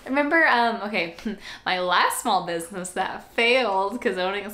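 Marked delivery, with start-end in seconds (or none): none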